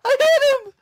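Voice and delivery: shouting, voice cracking high; excited shouting. Real, not acted. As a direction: yell it in outrage. Teammate denies his kill.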